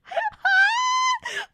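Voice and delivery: shrieking delighted laugh; high squealing laugh. Real, not acted. Fan squealing with delight at the hype moment. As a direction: squeal with glee at the scene.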